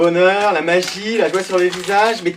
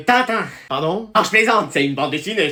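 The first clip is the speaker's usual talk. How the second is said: usual speaking voice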